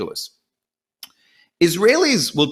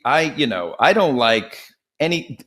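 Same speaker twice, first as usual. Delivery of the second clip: normal talking voice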